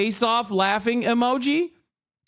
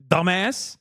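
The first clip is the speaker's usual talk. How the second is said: ordinary talk, nothing marked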